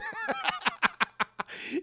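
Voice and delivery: high, wheezy burst of laughing; long helpless laugh; real laughter. Real, not acted. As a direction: crack up at your own impression. Laughing at own retelling.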